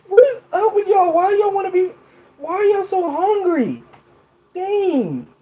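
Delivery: high-pitched incredulous scolding; mock exasperation. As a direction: scold them in a high, exaggerated voice. Scolding chat for being hungry.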